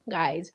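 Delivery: sighing "Guys" in a whisper; a tired whispered sigh; weary sigh. Real, not acted. Vlogger reacting to the post, sighing.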